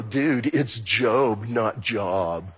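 low, slow, know-it-all correction of Job; drawn-out mock put-down from a spiritual know-it-all; correcting a pronunciation. Spiritual people correcting a newcomer's pronunciation, low and slow. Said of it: "the spiritual people go".

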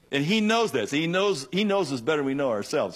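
knows hit high and loud, repeated; stress on knows. Real, not acted. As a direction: lean hard on each knows. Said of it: "preaching emphasis".

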